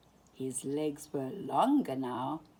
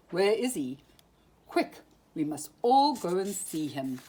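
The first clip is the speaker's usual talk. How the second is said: louder owl voice, urging everyone along; the owl rallying the animals, a bit louder; owl's eager call; 7 dB louder than usual. A narrator's reading. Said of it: "cried the owl".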